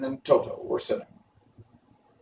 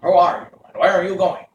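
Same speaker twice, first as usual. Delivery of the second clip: higher and louder bird voice; stork voice; 7 semitones higher, 8 dB louder than usual. A narrator's reading. Stork greeting strangers on the bank, higher and louder. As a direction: call out as the stork. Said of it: "asked the stork".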